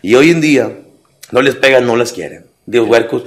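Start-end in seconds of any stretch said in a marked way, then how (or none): none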